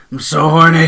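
imitating a breathless confession. Co-host imitating Andy on the phone to Jim, breathless. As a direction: say it breathlessly, mock-aroused. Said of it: "he says it breathlessly, too".

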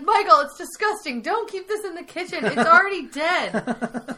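high-pitched, mock-disgusted scolding; loud, hammed-up exasperation; exasperated protest. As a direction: act out fed-up coworkers, dragging the final words. Coworkers' retold protest to their boss.

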